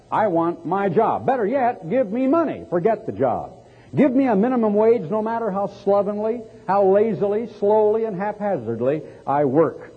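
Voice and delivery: high-pitched mock greedy demands; mock demanding. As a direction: act out a self-centered nation making demands. Voices a selfish, demanding nation.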